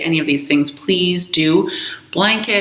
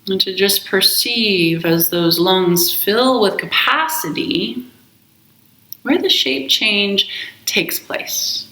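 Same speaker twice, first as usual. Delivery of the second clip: slow, calm meditation voice with long pauses; guided meditation; slower than usual. Acted. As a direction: guide them gently into the breath, unhurried. Leads a guided breath meditation.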